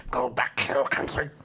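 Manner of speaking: lightly put-on British lady voice; crazy British lady. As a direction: imitate a British woman telling someone off. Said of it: "British lady who's like".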